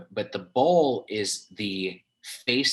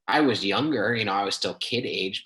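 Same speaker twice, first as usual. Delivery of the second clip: ordinary talk, nothing marked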